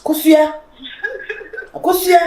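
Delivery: high, crying shout of her name; crying call. Acted.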